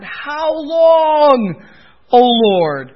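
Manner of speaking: high, loud anguished cry; cry from the heart. Retold or imitated. Preacher voicing the prophet crying out to God, high and loud. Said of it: "a cry from the heart".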